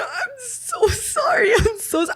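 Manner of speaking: retold weepy I'm so sorry; tearful apology. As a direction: sob out the sorry while laughing. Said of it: "I'm just crying".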